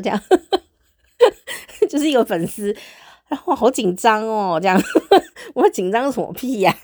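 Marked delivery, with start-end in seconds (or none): 1.2-1.8 loud, high bashful laugh; loud giggle. Real, not acted. Host laughs at her own fangirl comment, loud and high. 4.8-5.5 very high, loud embarrassed squeal; squealing laugh. Real, not acted. Host laughs at her silly nerves, high squeal.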